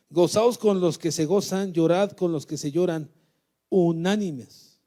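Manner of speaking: booming, loud scripture proclamation; preaching at full force. Acted. Preacher reading Romans to the congregation, loudly proclaimed. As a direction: thunder the verse out to the church.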